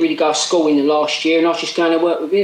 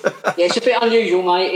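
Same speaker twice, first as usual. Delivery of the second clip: normal talking voice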